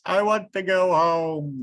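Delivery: high, loud wail. Acted. Bear after the wave wails to go home. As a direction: wail it like a miserable child. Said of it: "wailed Bear".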